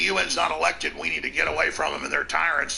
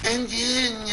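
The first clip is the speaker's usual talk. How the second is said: higher, smarmy put-on foreign accent, done badly; a botched try at a smarmy professor's accent; failed Harari accent; 6 semitones higher, slower than usual. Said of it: "in that weird Harari accent I really can't imitate".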